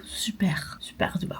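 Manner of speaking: soft unvoiced whisper; whispering over a crib. Real, not acted. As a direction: whisper so he won't wake. Whispers near sleeping Thomas. (chuchoté tout doucement)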